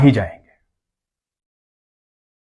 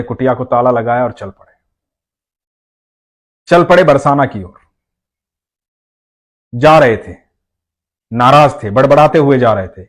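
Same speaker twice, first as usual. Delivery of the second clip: ordinary delivery, nothing marked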